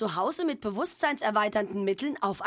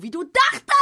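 shouted punchline; 8 semitones higher, 10 dB louder than usual. Acted.

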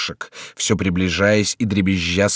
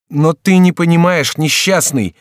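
high, loud exasperation; desperate raised-voice plea; desperate cry; 9 semitones higher, 6 dB louder than usual. A narrator's reading. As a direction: cry out in despair. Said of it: "вскричал Генрих в отчаянии".

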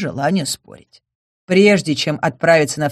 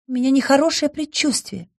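sad sigh; 8 semitones higher than usual. Acted. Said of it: "печально вздохнула элементаль".

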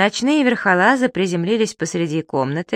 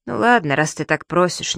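ordinary delivery

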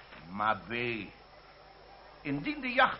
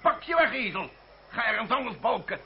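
angry outburst; 7 semitones higher than usual. Acted. Magistrate ordering the lawyer out, angry outburst. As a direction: throw him out like a braying donkey.

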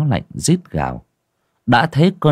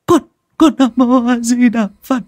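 high, stammering, louder cry of Nam Mô A Di Đà Phật; desperate louder prayer; 14 semitones higher, 4 dB louder than usual. Acted. Wife crying out her prayer in terror, stammering louder. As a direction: cry the prayer out, stuttering on con. Terrified woman praying, bowing.

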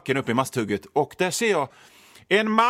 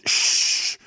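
whispered shush. Retold or imitated. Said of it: "Och hon".

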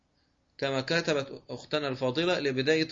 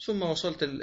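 ordinary talk

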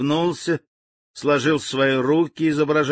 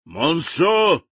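bellowing; 6 semitones higher than usual. Acted. General bellows for his colonel at full voice. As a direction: roar for the colonel. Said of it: "рявкнул генерал во всю глотку".

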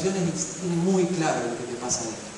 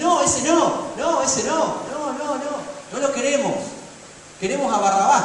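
crowd yelling; 8 semitones higher than usual. Retold or imitated. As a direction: shout it like an angry crowd rejecting him. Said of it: "Toda la multitud que está ahí, a veces dice".

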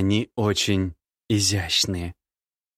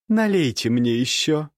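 tipsy woman; 5 semitones higher than usual. Acted. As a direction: ask for more wine. Nastasya voiced. (женский голос)

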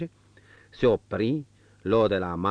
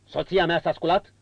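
1 stern master; 9 semitones higher, 6 dB louder than usual. A narrator's reading. Marquis questions valet.

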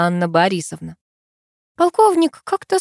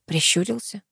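ordinary delivery

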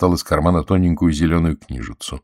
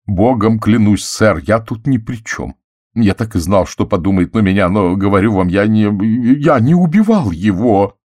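starts pleading, builds louder and higher until almost shouting; rising to a near shout; 4 semitones higher, 6 dB louder than usual. A narrator's reading. Butler protests his innocence to Poirot, building to a near shout. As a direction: panic climbing until it nearly breaks into a yell. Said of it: "Его голос поднялся почти до крика".

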